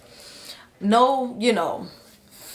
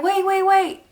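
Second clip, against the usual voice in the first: high, urgent repeated wait; frantic nurses telling her to wait; 12 semitones higher, 4 dB louder than usual. Retold or imitated. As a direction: nurses begging her to hold on. Nurses urging her to hold.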